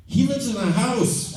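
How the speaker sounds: high, laughing punchline; laughing through it. Retold or imitated. Homeless guy introducing his friend, said while laughing.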